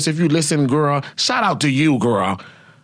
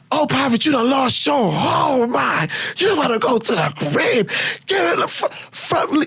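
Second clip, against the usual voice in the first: much higher sputtering shout; drill sergeant yell. Retold or imitated. Furious sergeant yelling at mouthy private, high sputtering shout. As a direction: yell and stumble over the commands.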